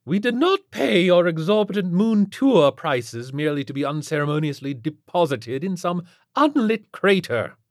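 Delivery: loud, high, haughty complaint; imperious billionaire's wife in high dudgeon; affronted rich matron. A narrator's reading. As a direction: complain with drawing-room outrage. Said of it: "she said in a voice that stopped just short".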